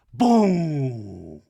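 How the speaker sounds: loud, long falling boom; bomb sound. Retold or imitated. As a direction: imitate a movie bomb, letting it ring out. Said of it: "You know that bomb sound".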